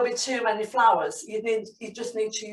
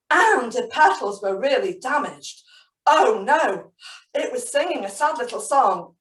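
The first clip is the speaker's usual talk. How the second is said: usual speaking voice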